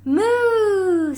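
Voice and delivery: a lightly voiced moo; cow moo. A narrator's reading. Cow climbing aboard with a light moo. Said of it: "said Cow".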